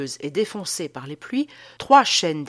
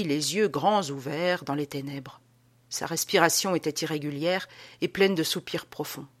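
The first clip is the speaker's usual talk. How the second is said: plain reading voice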